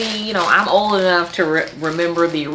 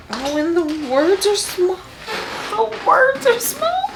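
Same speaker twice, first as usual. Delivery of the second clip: much higher, squeaky dismay at the tiny print; dismayed squeak; 11 semitones higher than usual. Real, not acted. Reader to her viewers, squealing in dismay at the tiny print. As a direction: squeal it out in disbelief, climbing up.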